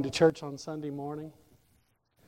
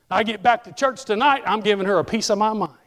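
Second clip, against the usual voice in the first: louder, worked-up imitation of an offended wife; mock indignant vow; 3 semitones higher, 5 dB louder than usual. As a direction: fume as if threatening a showdown. Acts wife vowing to confront lady.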